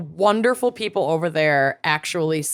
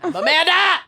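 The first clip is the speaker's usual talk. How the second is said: excited; 11 semitones higher, faster than usual. Real, not acted. Excited self intro, +11 semitones.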